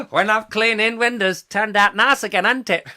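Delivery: high, loud comic catchphrase voice; hammed-up music-hall comedian. Host imitating an old film comedian, high cheeky voice. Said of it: "Oh, I'm [name]".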